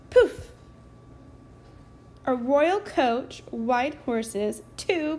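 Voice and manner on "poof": high, loud burst; magic poof. A narrator's reading. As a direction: pop the magic out. Magic wand wave.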